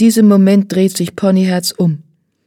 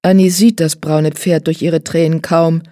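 usual speaking voice

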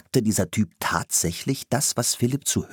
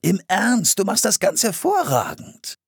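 high, enthusiastic compliment; earnestly delighted man; effusive praise; 13 semitones higher than usual. A narrator's reading. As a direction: gush with admiration. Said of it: "lobte der Mann weiter".

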